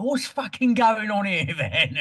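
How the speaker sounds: mock Cockney geezer, high and slow, laughing through it; mocking Cockney voice, drawn out with a laugh; Cockney hard man. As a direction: ham up a thick Cockney line from a badly written book. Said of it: "It's very Cockney".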